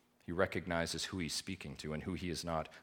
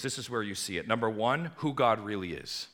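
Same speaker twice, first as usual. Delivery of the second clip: normal talking voice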